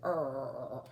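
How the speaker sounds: low, soft grumbling bear growl; muttered bear grumble. Acted. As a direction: growl it low under your breath like a bear. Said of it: "Old Bear muttered and growled".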